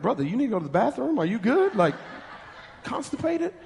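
soft, fast, laughing through it; laughing teasing question. Retold or imitated. Pastor teasing a straining brother, laughing. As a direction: tease him while cracking up. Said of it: "I'm like".

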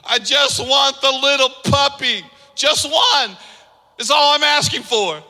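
put-on pleading, high little girl's voice; mock-despairing child begging for a puppy. Preacher reading a child's letter, high and pleading. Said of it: "Can you hear the despair in this little girl's voice".

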